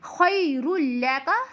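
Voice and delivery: slow "खैरुल लका", heavy "खै" and light "का"; thick kha, thin kaf. Shown as a demonstration. Teacher models the verse, slow with thick "खै". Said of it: "काफ को बारीक".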